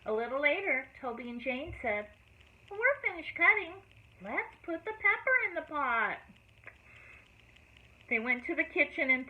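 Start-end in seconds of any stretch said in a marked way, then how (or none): 2.7-6.2 put-on children's voices. A narrator's reading.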